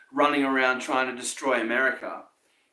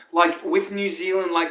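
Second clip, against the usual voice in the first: usual speaking voice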